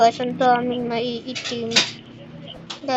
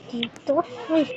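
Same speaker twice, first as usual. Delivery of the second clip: usual speaking voice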